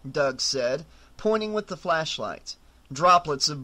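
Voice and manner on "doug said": ordinary delivery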